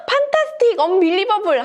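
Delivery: very high, thrilled exclamation; overjoyed amazement. Acted. Giraffe seeing its custom-made wings, overjoyed amazement. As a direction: marvel in a sky-high storybook voice. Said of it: "너무너무 즐거워하는 모습이에요".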